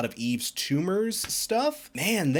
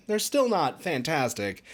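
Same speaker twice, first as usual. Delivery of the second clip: normal talking voice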